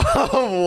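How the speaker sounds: a quick real laugh at his own bad luck; short laugh. Real, not acted. Streamer laughing as his internet drops, short laugh. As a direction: let out a brief amused chuckle.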